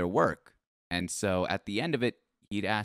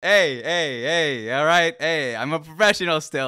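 calling out; 6 semitones higher, 6 dB louder than usual. Real, not acted. Calling out over laughter.

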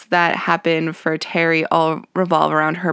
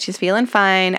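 ordinary talk

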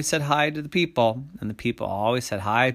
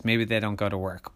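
plain reading voice

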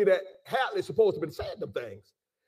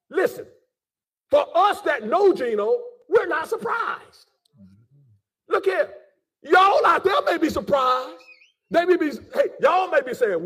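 raised, higher-pitched preacher's voice; impassioned preaching; 6 semitones higher than usual. Real, not acted. Preacher warns those who may be surprised, impassioned preaching. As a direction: press the point home to the congregation.

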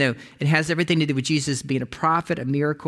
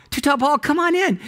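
calling out; 10 semitones higher, 3 dB louder than usual. Retold or imitated. Calling out to friend.

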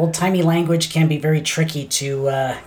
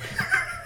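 high laugh; 9 dB quieter than usual. Real, not acted. Breaks up mid-reading.

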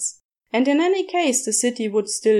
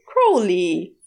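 a sharp scolding call of his name; chiding; 3 semitones lower, 4 dB louder than usual. A narrator's reading. Angel scolding the demon over the goose, chiding. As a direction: tell him off with just his name. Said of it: "Aziraphale chided".

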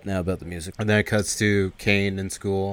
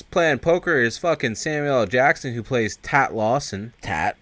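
usual speaking voice

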